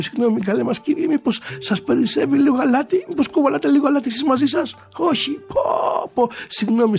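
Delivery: agitated polite pleading, climbing in pitch; frantic sailors. Acted. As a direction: ask around in a growing panic. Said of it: "αλαφιασμένοι κάτι ναύτες και μιλάγανε".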